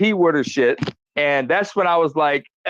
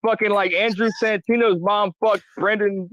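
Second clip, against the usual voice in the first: usual speaking voice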